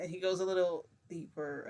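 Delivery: usual speaking voice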